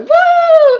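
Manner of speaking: high, loud, drawn-out victory whoop; celebratory whoop. Retold or imitated. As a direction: whoop like you just won. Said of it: "when I won, I was like".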